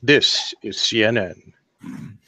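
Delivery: slow, deep mock newsreader; news anchor voice. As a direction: announce it like a TV anchor.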